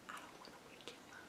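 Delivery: very faint whisper; barely audible whisper. Real, not acted. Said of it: "I may be talking a little low".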